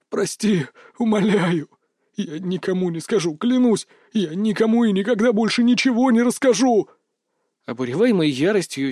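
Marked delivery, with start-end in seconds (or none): 0.1-6.9 high, tearful begging; almost sobbing. A narrator's reading. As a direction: beg through near tears. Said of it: "Заварский почти рыдал".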